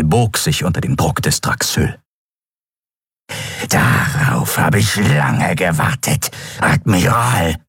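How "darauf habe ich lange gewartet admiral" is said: very slow, rasping creature voice; drawn-out menacing reptilian rasp; rasping reptile. A narrator's reading. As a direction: savour every word as a reptile predator. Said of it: "schnarrte der Draxyl".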